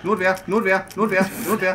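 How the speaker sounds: being chased. Real, not acted. Chanting while being chased.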